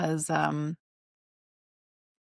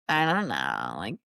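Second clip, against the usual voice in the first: much lower, creaky fried-out voice; vocal fry demo; 10 semitones lower than usual. Shown as a demonstration. Showing the cohost what verbal fry sounds like, low and creaky. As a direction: drop into a lazy creak on the words. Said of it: "can speak with verbal fry being like".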